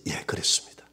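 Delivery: breathless whispered confession. Retold or imitated. Pastor answering God in the vision, whispered. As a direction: whisper it like a secret.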